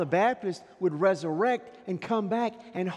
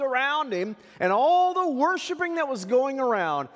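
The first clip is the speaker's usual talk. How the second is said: raised, impassioned preacher voice; higher and louder pulpit delivery; fervent preaching; 8 semitones higher, 8 dB louder than usual. Acted. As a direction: preach it out big to the congregation. Preaching at full force.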